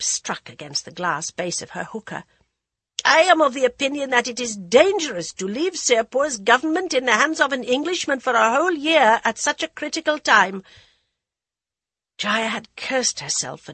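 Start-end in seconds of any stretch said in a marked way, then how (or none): 3.0-10.6 put-on loud, commanding old queen; authoritative dowager queen. A narrator's reading. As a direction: address the council with royal authority. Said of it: "strong with authority".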